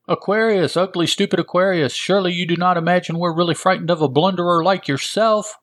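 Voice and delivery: high, fast mocking yell; taunting shout. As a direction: jeer at the villain. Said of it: "shouts Alan Scott".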